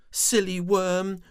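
high, loud scolding worm. Acted. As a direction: scold him like a big sister. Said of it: "she scolded".

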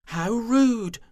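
high, loud indignant cry; indignant squeal. Acted. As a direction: squeal it out, offended. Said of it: "they declared".

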